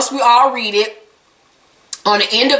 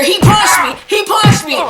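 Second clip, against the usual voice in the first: mock scream; 9 semitones higher, 3 dB louder than usual. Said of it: "we hear".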